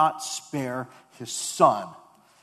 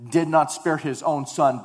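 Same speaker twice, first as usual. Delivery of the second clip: ordinary delivery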